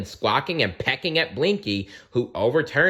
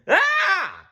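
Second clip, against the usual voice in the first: high, loud panicked scream; 5 dB louder than usual. Acted. Blinky screams trapped in the shed. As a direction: scream while fumbling at the door. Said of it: "screamed Blinky".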